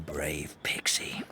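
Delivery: hushed, reverent whisper to a small friend. Acted. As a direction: breathe the words as a blessing. Elder blessing the little helper.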